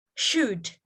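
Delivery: should with a clear sh sound; correct sh. Shown as a demonstration. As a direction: give should its proper sh. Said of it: "supposed to be".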